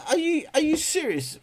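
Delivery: shocked squeak; incredulous. Real, not acted. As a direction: sound genuinely astonished. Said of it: "reacts in disbelief".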